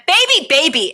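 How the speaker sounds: high-pitched mock chiding; quick, high imitated reproach; scolding nail technician. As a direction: imitate the salon lady tutting. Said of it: "the lady went".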